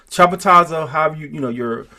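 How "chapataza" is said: chapataza with an open first "CHA", higher and louder; alternative pronunciation. Shown as a demonstration. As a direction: punch out chapataza as CHA-puh-TA-za. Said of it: "your speech pattern is".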